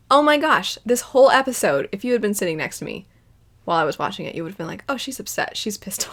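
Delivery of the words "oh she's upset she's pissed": whispered, amused remark; soft unvoiced gossip; hushed aside. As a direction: whisper it like a nosy onlooker. Imagined onlooker's hushed comment.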